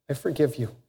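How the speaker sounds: soft, low and gentle; quiet forgiveness. Retold or imitated. Former prisoner tells his old guards, softly and tenderly. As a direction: offer forgiveness quietly, almost to himself.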